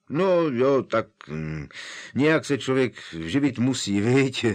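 a lightly voiced shifty grumble; grumbling Mundungus. Mundungus excuses selling junk, mild grumble. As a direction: shrug it off like a petty crook. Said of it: "zabručel Mundungus".

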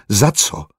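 short, puzzled question; baffled blurt. A narrator's reading. Smug man caught off guard by her question, baffled. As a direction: blurt it with mild incomprehension. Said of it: "nechápavě vyhrkl".

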